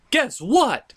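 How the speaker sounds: normal talking voice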